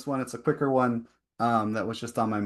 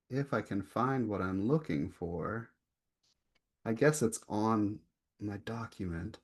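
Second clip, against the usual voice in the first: usual speaking voice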